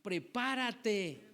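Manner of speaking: usual speaking voice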